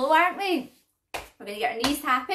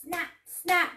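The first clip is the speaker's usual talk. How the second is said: crocodile snap; 3 semitones higher, much slower than usual. Pretend. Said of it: "What about a crocodile?".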